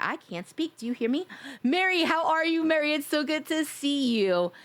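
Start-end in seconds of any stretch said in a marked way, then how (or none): 1.6-4.5 higher, slowed, gushing hello; excited greeting. Real, not acted.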